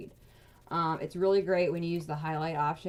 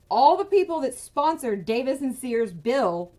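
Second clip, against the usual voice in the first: louder, higher, slowed and hammered out; real anger; 5 semitones higher, 9 dB louder than usual. Real, not acted. Ranting to viewers about the bill's sponsors, angry and loud. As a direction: let the outrage burst out word by word. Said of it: "this is making me even more mad".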